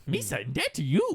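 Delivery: lightly put-on goofy alien catchphrase; playful mimicry of a film creature; Jar Jar imitation. Quoting Jar Jar after answer.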